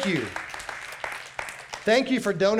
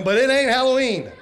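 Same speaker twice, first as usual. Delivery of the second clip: laughing punchline; 5 semitones higher, 6 dB louder than usual. Real, not acted. Mocking church fake Halloween events.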